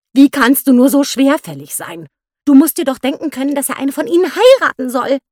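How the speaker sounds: lauter, vorwurfsvolle Ehefrau; exasperated scolding. A narrator's reading. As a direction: den begriffsstutzigen Mann tadeln. Wife scolds slow husband. (Ehefrau schilt ihren Mann, lauter und höher)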